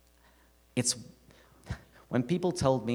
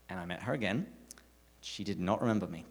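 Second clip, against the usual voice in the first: ordinary talk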